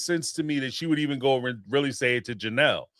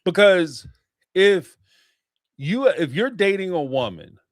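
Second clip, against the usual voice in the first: usual speaking voice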